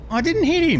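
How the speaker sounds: mock innocent protest. Joking he's speeding, squeaks a denial to the officer. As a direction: squeak out a fake denial of guilt.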